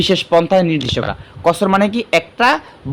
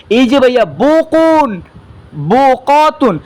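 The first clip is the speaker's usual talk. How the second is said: বকুন and বকতুন said high, loud and slow; teacher highlights বকুন for the class, high and loud; emphatic example; 8 semitones higher, 4 dB louder, much slower than usual. Shown as a demonstration. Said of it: "যেমন এই যে ভাইয়া লিখছিলাম নিজের হাতে".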